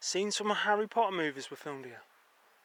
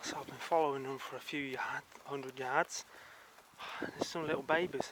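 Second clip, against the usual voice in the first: normal talking voice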